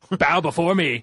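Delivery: high, loud, commanding decree; dark lord. Pretend. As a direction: demand worship like a tyrant. Mock title, High Lord.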